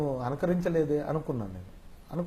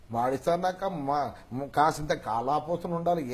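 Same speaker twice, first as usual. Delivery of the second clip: bold, drawling film-villain impersonation; Rao Gopala Rao; slower than usual. Acted. Boss lectures his secretary on art, bold slow impersonation. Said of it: "Rao Gopalarao గారు".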